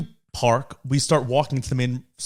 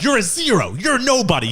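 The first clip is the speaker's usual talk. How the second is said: higher, mocking dismissal; dad mocks the girl, pitched up; dad's put-down; 8 semitones higher than usual. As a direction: brush her off as nobody. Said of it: "Dad's like".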